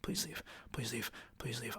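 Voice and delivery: barely audible, breath-only begging; whispered plea. Retold or imitated. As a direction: whisper it over to yourself like a desperate wish. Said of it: "close my eyes and go".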